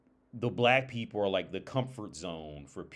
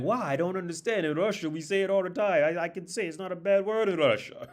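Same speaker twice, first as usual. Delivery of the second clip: high-pitched, baffled Russian protest; Russian accent; 11 semitones higher, 6 dB louder than usual. Retold or imitated. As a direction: mimic a Russian insisting it's fine, pitched way up. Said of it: "with the Russian accent".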